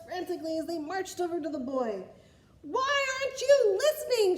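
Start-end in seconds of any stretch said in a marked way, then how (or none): 2.6-4.4 much higher, loud frustrated yell; king shouting. Acted. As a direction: shout at the boy who won't listen. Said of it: "shouted the king".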